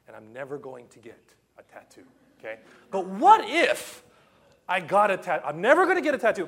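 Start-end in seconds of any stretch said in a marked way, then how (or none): 2.9-5.4 tempted what if. Retold or imitated. Tempted inner voice, loud slow. 5.5-6.5 rushed, loud and high, tripping over the words; flustered blurt. Retold or imitated. Preacher arguing with himself over a tattoo, in a rushed loud blurt. Agitated inner debate, fast.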